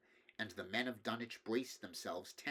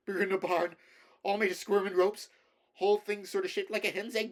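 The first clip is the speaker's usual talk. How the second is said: higher, louder, breathless rustic babble; frantic terror; 9 semitones higher, 9 dB louder than usual. A narrator's reading. Shaken farmer gasping out to neighbours what he saw, panicked. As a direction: tumble out the words in panic, stammering. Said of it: "Curtis was past all coherence".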